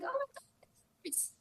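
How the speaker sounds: tiny high, soft voice; small, high imitated whimper; mock pained squeak. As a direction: squeak it out as if hurt. Voices Luffy hurt by the punches.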